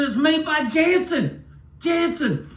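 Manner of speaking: high, loud and slow, savoring each word; triumphant gotcha. Retold or imitated. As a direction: draw out the name like a gotcha. Triumphant discovery of the maker.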